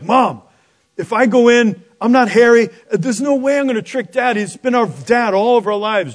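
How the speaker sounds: high, loud pleading son; hammed-up panicky objection to mom; acted protest. Acted son protesting to his mother.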